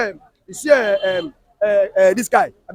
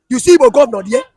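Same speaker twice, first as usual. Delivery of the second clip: usual speaking voice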